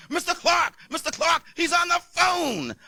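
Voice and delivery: high, excited secretary calling out; a secretary running in with big news; frantic secretary. Retold or imitated. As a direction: squeal it like a breathless secretary. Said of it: "my secretary came running out".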